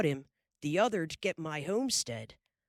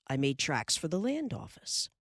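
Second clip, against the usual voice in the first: usual speaking voice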